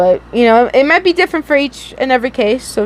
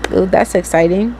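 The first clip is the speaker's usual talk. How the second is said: usual speaking voice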